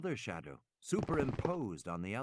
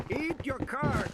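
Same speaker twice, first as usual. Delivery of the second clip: crackly radio; 13 semitones higher, 5 dB louder, slower than usual. A narrator's reading. Garbled voice over crackling radio.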